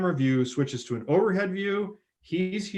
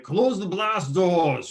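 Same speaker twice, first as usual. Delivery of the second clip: put-on Japanese accent; 6 semitones higher, 6 dB louder, much slower than usual. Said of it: "speak in a Japanese accent".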